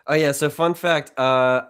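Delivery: ordinary talk, nothing marked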